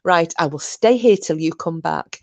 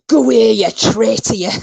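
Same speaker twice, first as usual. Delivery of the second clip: rough attempt at a higher, louder Irish accent; self-confessed clumsy Irish accent, pitched up and louder; 6 semitones higher, 6 dB louder than usual. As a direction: throw out a mock-angry Irish jab. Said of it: "I can't do the accent".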